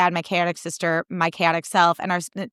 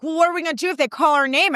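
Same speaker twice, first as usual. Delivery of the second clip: fretting dad; 8 semitones higher, faster than usual. Retold or imitated. Dad freaking out about the waitlist, higher and fretting. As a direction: fuss over the logistics. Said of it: "freaking out at the logistics".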